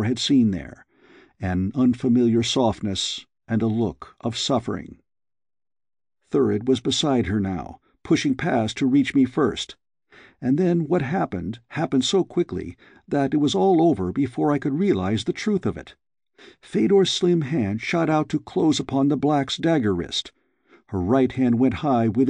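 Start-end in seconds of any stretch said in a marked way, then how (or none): none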